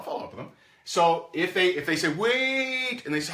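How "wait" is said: calling out. Retold or imitated. Prospect calling out to stop the call, high cry. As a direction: call them back before they hang up.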